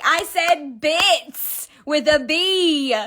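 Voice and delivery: slow, careful "bits with a B", stressing the B; sheepish husband correcting, slowly stressing "bits"; embarrassed correction. Retold or imitated. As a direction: spell it out slowly with an emphatic B. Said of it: "and he was like".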